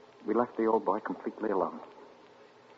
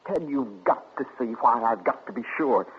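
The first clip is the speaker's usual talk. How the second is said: desperate plea; 3 semitones higher, 5 dB louder than usual. Acted. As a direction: plead with rising agitation. Pleads his wife is innocent.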